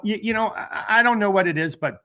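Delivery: ordinary delivery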